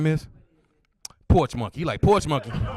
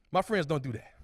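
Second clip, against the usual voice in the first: ordinary talk, nothing marked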